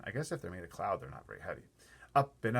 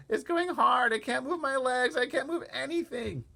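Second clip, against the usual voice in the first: moaning; 14 semitones higher, 5 dB louder than usual. Acted. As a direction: keep moaning in despair. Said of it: "he moaned".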